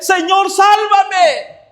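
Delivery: drawn-out, high, loud plea for rescue; slow, high, shouted desperate "Señor, sálvame"; desperate cry. Acted. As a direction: cry out like a drowning man. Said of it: "desesperado, dice".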